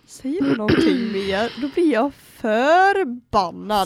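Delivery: slow, drawn-out re-enacted threat; warning. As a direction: stretch the words into a deliberate warning. Acted warning to the cocky girl. (långsam, återgiven varning)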